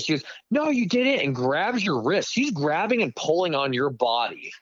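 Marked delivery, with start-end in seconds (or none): 0.5-1.2 high-pitched mock contradiction; put-on high voice, arguing back; imitating arguing bartender. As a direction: mimic her denial in a high voice. Acting out bartender arguing over payment.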